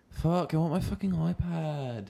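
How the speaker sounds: high, drawn-out mock whine; American girl whine. Teasing the South African friend about whining, high mock whine. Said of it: "you still whine like an American girl".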